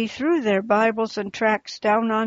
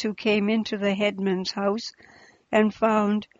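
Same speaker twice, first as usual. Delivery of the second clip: ordinary delivery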